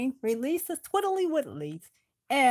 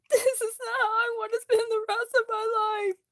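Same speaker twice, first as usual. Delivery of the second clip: high, fast sobbing lament; high tearful sobbing wail; crying; 7 semitones higher than usual. A narrator's reading. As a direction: Bilbo crying in despair. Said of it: "Bilbo started crying".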